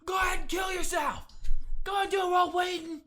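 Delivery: high, loud yelled taunt; Kramer shout. Retold or imitated. Podcaster voicing Kramer yelling at Newman to jump. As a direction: yell it up at him like Kramer. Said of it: "Kramer was just like".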